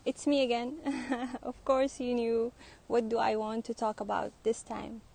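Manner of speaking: normal talking voice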